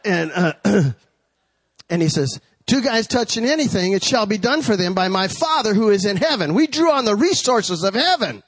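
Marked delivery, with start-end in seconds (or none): 2.7-8.4 raised, driving pulpit recitation; proclaiming scripture to a congregation; preached scripture. Acted. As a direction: declare the verse in a building preacher's rhythm.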